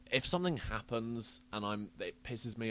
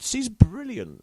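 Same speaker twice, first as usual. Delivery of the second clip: gushing praise; 8 semitones higher, 11 dB louder, much slower than usual. Real, not acted. Praising child actress emphatically.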